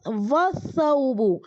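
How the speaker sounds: वस्सौबु slowly, the s doubled, no lam; slow Arabic demo; sun letter demo. Shown as a demonstration. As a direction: stretch वस्सौबु out for students. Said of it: "इसका हम pronunciation कैसे करेंगे?".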